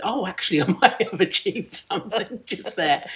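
laughing realisation. Retold or imitated. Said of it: "it was only then that I realised".

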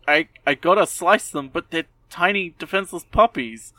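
hammed-up dilemma over cute puppies; mock anguished Bort. Bort torn about slicing puppies.

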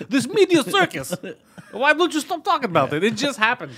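high, exasperated impression through laughter; Khabib. Fighter fed up with the media circus, mock exasperated. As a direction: whine in hammed-up disbelief. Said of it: "He's like".

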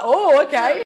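mock surprised reaction; loud surprised oh okay. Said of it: "Stephen's like".

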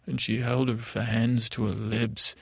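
usual speaking voice

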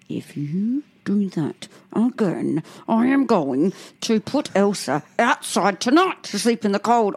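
put-on slow, deliberate menacing threat to a child; stern parent threat. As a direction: lean in and threaten slowly. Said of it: "I'm right down to her level".

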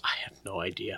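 soft whispered reply; whispering. Acted. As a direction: breathe the answer as a secret.

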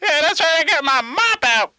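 high, put-on comic voice; Tony Zamboni. Tony telling a caller about cleaning ice, high character voice. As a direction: play the phone character up high. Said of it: "Mister Tony?".

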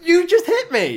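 high, loud yelp of protest; shocked outcry. Real, not acted. Just hit by his co-host, high indignant outcry. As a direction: cry out, pitch leaping way up.

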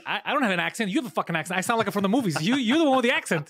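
worked-up comeback, rising in pitch and force; retold indignant anger. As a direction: re-enact an offended retort, building to loud. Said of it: "j'étais vraiment fâché".

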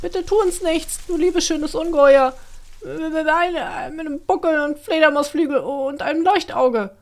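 quavering creature pleading for mercy; shaky pleading. A narrator's reading. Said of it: "flehte da jemand mit zittriger Stimme".

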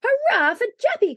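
high, loud hurrah; cried-out cheer. A narrator's reading. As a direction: lead the room in a cheer. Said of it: "cried Santa Claus".

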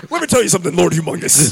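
a hint of a wrestler promo; lightly voiced, laughing wrestler; Hulk Hogan. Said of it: "their Hulk Hogan. He's like".

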